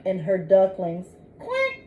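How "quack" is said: high soft quack; duck quack. Acted. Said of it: "says the mother duck".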